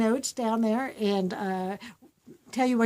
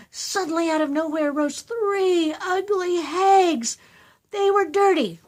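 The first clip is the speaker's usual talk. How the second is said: put-on higher, louder, slow eager kid; child reading proudly; 7 semitones higher, 5 dB louder than usual. Child voiced rereading story.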